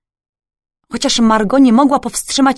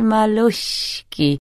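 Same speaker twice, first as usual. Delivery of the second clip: singsong whisper; much slower than usual. A narrator's reading. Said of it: "wyszeptał śpiewnie".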